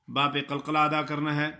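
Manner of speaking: usual speaking voice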